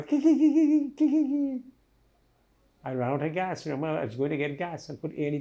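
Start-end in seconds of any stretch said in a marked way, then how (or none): 0.0-1.6 high mock engine cranking; imitated car starter. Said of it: "And I hear it go".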